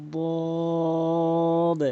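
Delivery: বদ as a long, held Arabic dad; tongue edge on the molars, draw out বদ; Arabic dad demo. Shown as a demonstration. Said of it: "এভাবে সঠিকভাবে উচ্চারণ হবে". (বদ জিহ্বার কিনারা মাড়িতে লাগিয়ে টেনে)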